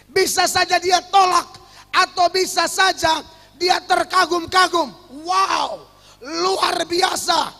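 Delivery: loud, emphatic sermon delivery; full-force preaching. Acted. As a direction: proclaim it to the congregation.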